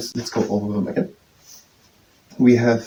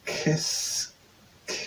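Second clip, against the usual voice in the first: "Qu'est-ce que" broken up, soft and drawn out; sounding out syllables; 7 dB quieter than usual. Real, not acted. Learner working out which syllable it is, sounding out slowly. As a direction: split "Qu'est-ce que" slowly, softly, piece by piece. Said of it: "I try to understand where, what syllable it is".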